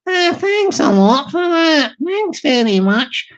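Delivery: a light put-on voice for the small customer.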